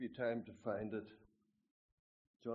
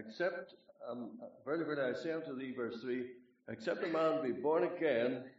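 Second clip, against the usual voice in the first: scripture reading; 3 semitones higher, 5 dB louder than usual. Acted. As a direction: declaim the verses with weight. Rereads the verse aloud.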